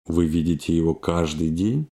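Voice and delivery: normal talking voice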